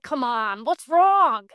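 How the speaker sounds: loud, high, exasperated coaxing; imitated pushy demand, punched hard at the end; insistent prodding. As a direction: press for the truth, rising to a shout. He presses her, acted out.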